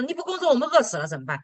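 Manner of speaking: high, fast mock panic; panicked children. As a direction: kids blurting out their worry. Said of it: "那三个孩子说". (孩子们慌张地快速追问)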